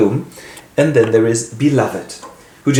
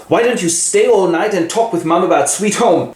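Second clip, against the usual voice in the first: snide Denver; 8 semitones higher than usual. Acted. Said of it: "And she goes".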